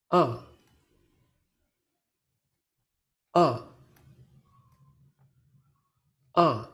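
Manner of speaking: short, strong "Ah" dropping fast; sharp falling tone. Shown as a demonstration. Teacher models the fourth tone for learners, "Ah" falling quickly. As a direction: drop "Ah" firmly like a car rushing downhill. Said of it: "Your voice should also fall quickly and strongly".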